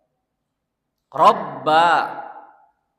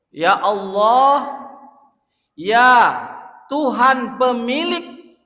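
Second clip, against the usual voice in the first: much higher, louder, slow crying invocation; full-force preaching; 6 semitones higher, 7 dB louder, slower than usual. Acted. Preacher crying out to God before the congregation, high and loud.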